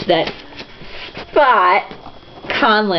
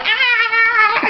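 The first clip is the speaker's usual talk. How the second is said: happy yell; 13 semitones higher than usual. Retold or imitated.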